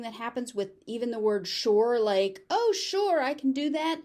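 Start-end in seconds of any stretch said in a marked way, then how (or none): 2.5-4.0 high, louder cheerful reply; eager agreement. Acted. Teacher acting an example reply to a request, eager agreement. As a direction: agree readily, pitched well up.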